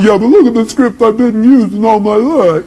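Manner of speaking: high mock whine; whining objector. Speaker mimicking an audience member's objection, whining. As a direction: imitate someone clinging to an old excuse. Mocking an objector clinging to script.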